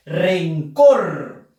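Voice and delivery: "Rencor" loud, with a punched COR; teacher demonstrating the stressed COR in "Rencor". Shown as a demonstration. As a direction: throw the weight onto the end of "Rencor". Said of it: "Fuerza de voz en la sílaba cor".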